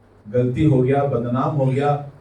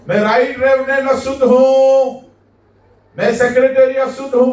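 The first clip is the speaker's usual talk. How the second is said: higher, louder calling out; raised voice of a fallen leader; changed leper's cry; 8 semitones higher, 5 dB louder than usual. Retold or imitated. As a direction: cry out his new shameful title. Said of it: "अब इसकी बोली बदल गई है".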